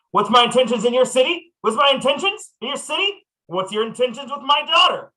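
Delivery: loud, high yelling dad; gruff overprotective dad. Acted. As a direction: yell it like a furious father. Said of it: "I start just yelling loudly".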